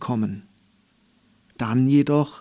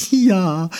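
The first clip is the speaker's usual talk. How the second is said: eager jeer; 7 semitones higher, 6 dB louder than usual. Acted. Dandelion answers.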